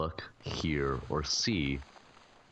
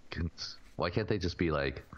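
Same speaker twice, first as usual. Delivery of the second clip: ordinary talk, nothing marked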